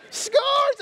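very high, loud victory squeal; squealed kid cheer. Retold or imitated. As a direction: squeal it at the top of your voice like a thrilled toddler. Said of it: "he's all excited".

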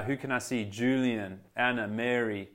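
ordinary delivery

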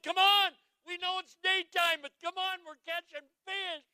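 high, loud excited shout to friends; excited calling out. Acted. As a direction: call them over, thrilled. Fishermen calling their friends over.

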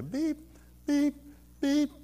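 sparse, soft beeps with long gaps; heart monitor beeps. Retold or imitated. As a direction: imitate a monitor slowing toward a stop. Imitates heart monitor slowing to stop.

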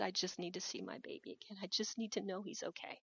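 very soft, subdued pleading; hushed retold plea. Grieving mother pleading to see her son, hushed. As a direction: quote her plea almost under the breath.

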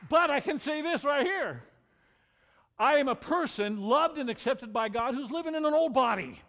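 loud and high, proclaiming; rousing proclamation. Real, not acted. Preacher to the congregation, loud rousing proclamation. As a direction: lift the whole room with it.

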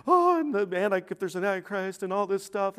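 mock fretting, starting high and loud; alarmed people imitated. Preacher imitating people talking about demons, mock alarm. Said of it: "when people start talking about demons".

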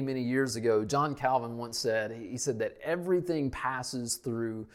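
ordinary talk, nothing marked

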